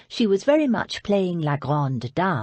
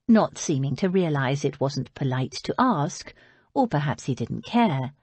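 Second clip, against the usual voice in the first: plain reading voice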